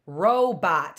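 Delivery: "robot" split into two beats, ro, bot; two stops. Shown as a demonstration. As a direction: give each syllable of "robot" its own beat. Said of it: "Two stops".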